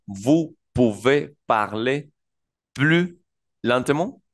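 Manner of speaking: slow "Vous pouvez parler plus lentement?" rising on "plus"; slow French demo. Shown as a demonstration. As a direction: model the French phrase slowly, lifting "plus". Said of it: "Entonces decimos". (demostración lenta en francés)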